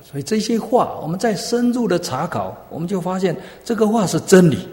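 high, forceful pulpit conclusion; emphatic preaching. Acted. Preacher concluding for the congregation that these words are truth, emphatic preaching. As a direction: build to a loud declaration of truth.

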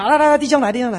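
loud, high calling to a passerby. Retold or imitated. Preacher retelling how he called the brother over, loud. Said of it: "我就说".

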